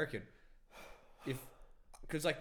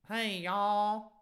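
high, loud, drawn-out Texan drawl; hammed-up Texan girl accent; Texan woman accent; 10 semitones higher, 5 dB louder, much slower than usual. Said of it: "That's, like, Texan".

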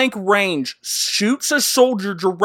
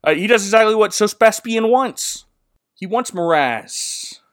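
ordinary talk, nothing marked

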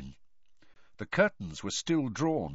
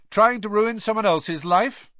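loud, raised challenge, high; loud accusation; 10 semitones higher, 7 dB louder than usual. A narrator's reading. Harry confronts reporter Rita in the pub, loudly.

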